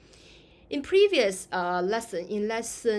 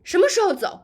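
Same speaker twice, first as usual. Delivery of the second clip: higher, louder, fast impatient 什么时候走; angry; 10 semitones higher, 11 dB louder, faster than usual. Shown as a demonstration. Teacher acts an angry traveller asking when to leave, angry. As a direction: snap it out impatiently. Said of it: "If you are angry".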